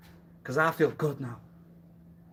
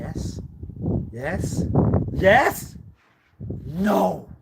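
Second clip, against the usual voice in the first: rising yes, shouted no; 5 semitones higher, 7 dB louder, much slower than usual. Acted.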